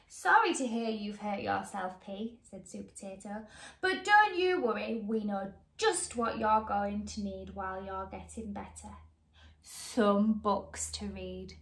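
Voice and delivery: put-on lively read-aloud to children; bright, animated storybook reading; story narration. A narrator's reading. Reading a picture book to young viewers, lively.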